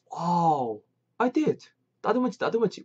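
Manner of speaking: high-pitched, delighted confirming; acted excited admission. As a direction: confirm it with amazed glee. Dialogue reply, amazed confirmation.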